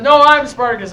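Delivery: loud defiant claim; loud declaration. Retold or imitated. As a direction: step forward and claim it loudly. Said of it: "stands up and says".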